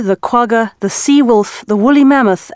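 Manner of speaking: usual speaking voice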